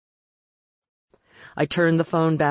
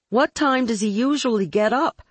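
high-pitched, raised voice just short of a yell; almost yelling; 7 semitones higher than usual. A narrator's reading. Caller trying to be heard over joyful effusions, almost yelling. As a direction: raise your voice over the gushing. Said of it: "I almost yelled".

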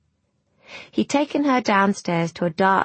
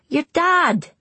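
high-pitched impatient lad; exasperated boy; 10 semitones higher, 4 dB louder than usual. A narrator's reading. Boy rolls his eyes at the girl, exasperated. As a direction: explain the obvious, pitched well up.

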